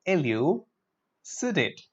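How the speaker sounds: "Elucidate" very slowly, i-lyoo, si-date; syllable-by-syllable pronunciation. Shown as a demonstration. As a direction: break "Elucidate" in two slow halves. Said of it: "Look at the pronunciation".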